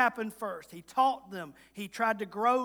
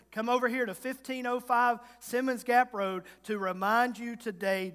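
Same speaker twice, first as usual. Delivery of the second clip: ordinary delivery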